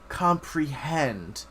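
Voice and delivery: normal talking voice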